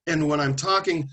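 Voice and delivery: usual speaking voice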